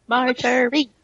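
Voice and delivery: half-hearted chant of a name; mock crowd shout. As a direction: call it out like a spectator, without real volume. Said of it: "let's just shout names".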